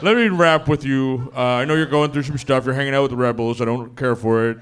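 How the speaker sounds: loud, put-on dad having a heart-to-heart; playing a chummy father who wants a serious talk; Darth Vader. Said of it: "where Darth Vader turns the chair".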